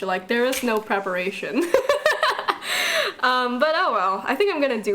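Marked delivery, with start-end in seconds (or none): 1.7-3.1 high, rapid peal of laughter; real laugh. Real, not acted. Homeschooler facing real college with no preparation, helpless laugh. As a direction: burst out laughing, high-pitched.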